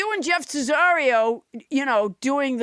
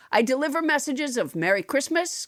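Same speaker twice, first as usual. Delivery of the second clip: ordinary talk, nothing marked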